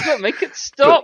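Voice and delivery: mock pleading.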